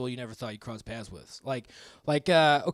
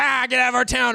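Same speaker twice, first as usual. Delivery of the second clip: higher, louder hammed-up shout; mock yell; 8 semitones higher, 7 dB louder than usual. Comic joins chasing yuppies, hammed-up yell. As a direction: chase them off with a yell.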